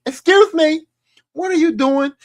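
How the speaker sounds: high, loud stern mother; mock mom barging into the room; indignant mom. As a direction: play a mother catching her daughter. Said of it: "and the mom be like".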